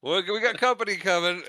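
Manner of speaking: lightly acted shout to a partner; mock urgent call. Han warning Luke after shooting the comm, mock urgent.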